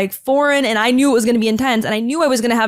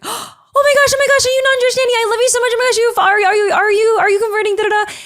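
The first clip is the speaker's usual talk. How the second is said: much higher, louder, fast squealing gush; gushing excitement; 11 semitones higher, 6 dB louder, faster than usual. Retold or imitated. Congregants swarming the shy newcomer, imitating their squealing gush. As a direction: mimic a crowd of overexcited fans.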